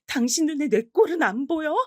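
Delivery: high, sharp angry question; angry retort. Retold or imitated. Wife snapping at her husband, high and angry. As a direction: snap it at him in frustration.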